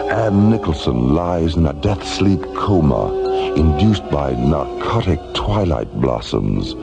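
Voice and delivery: usual speaking voice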